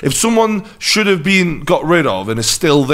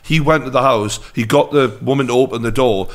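normal talking voice

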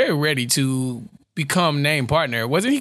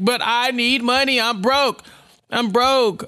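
mock desperate pleading; 8 semitones higher, slower than usual. Acting Jack pleading with Jessica.

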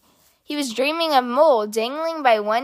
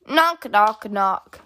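ordinary delivery, nothing marked

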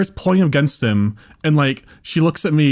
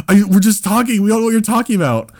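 higher, hurried pleading; desperate protest; 6 semitones higher than usual. Retold or imitated. Desperately trying to convince the mother, high pleading voice. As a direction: plead innocence in a rush. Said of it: "we're just desperately trying to convince".

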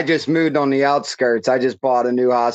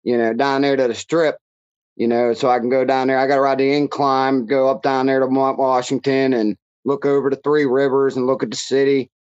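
thick Pittsburghese, vowels flattened; Pittsburgh accent. Shown as a demonstration. Pittsburgher showing podcast host the local talk, Pittsburgh accent. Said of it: "that kinda accent".

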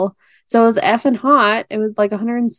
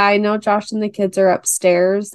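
usual speaking voice

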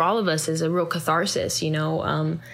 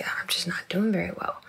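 soft, half-whispered confession; hushed, understated admission; quiet admission; 5 dB quieter than usual. Retold or imitated. Retold admission of feeling low.

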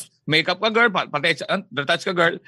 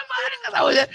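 laughing yell. Real, not acted. Laughing yell after gossip quote.

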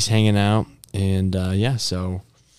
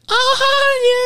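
mock surprised voice; 4 dB louder than usual. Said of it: "I was like".

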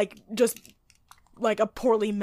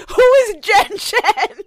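laughing; 12 semitones higher, 11 dB louder, slower than usual. Real, not acted. Amused question about a character.

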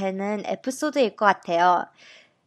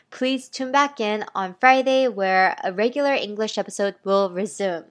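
ordinary talk

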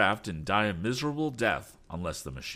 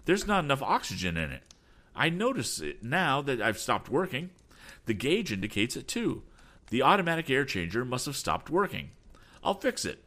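alarmed, higher-pitched report; mild alarm in a raised voice; startled exclamation; 7 semitones higher than usual. A narrator's reading. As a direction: report the trouble with urgency. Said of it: "He uttered a startled cry".

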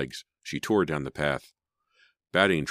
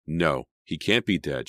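ordinary delivery, nothing marked

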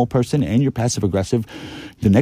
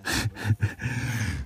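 soft, helpless chuckle; quiet giggle; 11 dB quieter than usual. Real, not acted. Laughing at the creepy stalker message, soft chuckle.

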